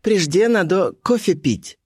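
put-on high, slow girlish character; Colibri's voice. A narrator's reading. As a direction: insist in a high, drawn-out voice. Colibri insists on coffee.

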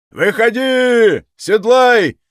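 high, loud, drawn-out command; shouting from yard. A narrator's reading. Cossacks rouse the men from outside, shouting. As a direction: call the men out across a yard. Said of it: "закричали со двора".

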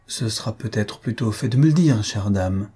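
ordinary delivery

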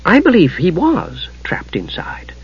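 slow, whispery musing; thoughtful. A narrator's reading. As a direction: think aloud in a hushed, slow voice. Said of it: "Gillian said thoughtfully".

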